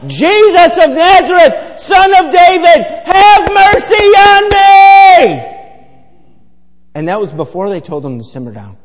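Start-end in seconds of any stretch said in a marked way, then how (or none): 0.1-5.5 very high, slow, loud cry for mercy; drawn-out loud beggar's wail; screaming plea. Acted. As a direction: scream it like a desperate blind beggar. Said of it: "screaming and yelling".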